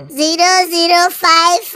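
normal talking voice

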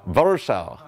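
Warsaw landed as "VAR-shah"; Polish pronunciation. Shown as a demonstration. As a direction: say "Warsaw" the local way, with V and sh, settled. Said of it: "nothing like they pronounce it".

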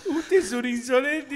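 high, slow quote with laughter; mock-stern line said through laughing; retold indignant scolding. Old man scolding Marcelito, laughing mock indignation. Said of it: "Le dijo".

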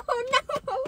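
high squeaky laugh through the words; laughing at her own mishap, half dismayed. Real, not acted. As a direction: giggle it out in dismay.

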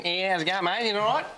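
put-on Australian accent. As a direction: greet them like an Australian mate. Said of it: "but Australian".

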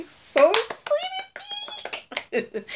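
very high, long drawn-out squeal; excited squeal. Real, not acted. Happy about the gift shirts, squealing with excitement. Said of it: "My niece used to do that".

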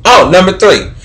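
ordinary talk, nothing marked

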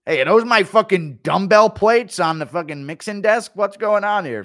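loud, high angry yell; shouting at the mess in his weight room; angry Chandler. Acted. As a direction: catch someone wrecking your gym. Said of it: "Impression of Chandler's weight room".

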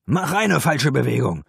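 loud, high threatening roar; roaring threat. A narrator's reading. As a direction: bellow the dare at him. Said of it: "brüllte er".

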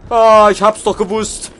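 loud, high alarmed cry; startled shout. Real, not acted. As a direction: yell it as the chaser appears. Enemy appears, startled.

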